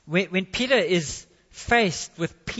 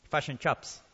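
FISH AND CHIPS said as "fush and chups" in a New Zealand accent; low, soft New Zealand "fush and chups" for "fish and chips"; 6 semitones lower, 5 dB quieter than usual. Shown as a demonstration. Preacher teases New Zealanders over their giveaway accent, New Zealand accent. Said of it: "the accent gives him away".

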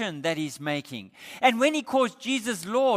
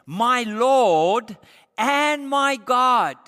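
louder, slow proclaimed confession; awed declaration; 3 semitones higher, 7 dB louder, much slower than usual. Acted. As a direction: proclaim it slowly with awe. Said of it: "Listen to what he says".

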